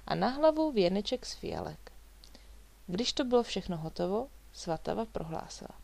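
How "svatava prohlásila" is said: plain reading voice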